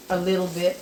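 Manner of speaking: ordinary talk, nothing marked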